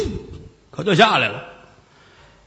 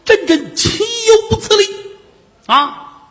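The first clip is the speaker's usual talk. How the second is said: high, rising outraged cry that climbs on every syllable; 14 semitones higher than usual. Acted. Old immortal berating the mad monk, outraged high cry. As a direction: cry it out as if the insult were unbearable.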